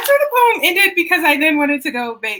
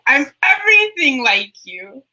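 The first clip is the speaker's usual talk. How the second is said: climactic refrain. Acted. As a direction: land the final line with full force. Poem refrain, climactic take.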